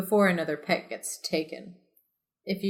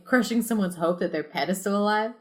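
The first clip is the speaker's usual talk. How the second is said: ordinary talk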